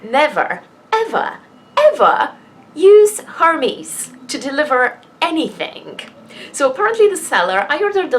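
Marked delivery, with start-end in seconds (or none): 0.0-6.0 a public warning to viewers, pitch climbing on each ever; emphatic warning. Real, not acted. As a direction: hammer home the warning, each ever higher. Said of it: "the P S A in this video".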